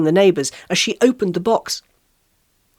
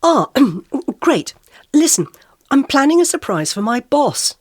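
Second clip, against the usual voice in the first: higher, flustered chatter; pitched-up, over-eager rambling; babbling; 7 semitones higher than usual. A narrator's reading. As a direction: babble it out nervously. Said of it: "I babbled".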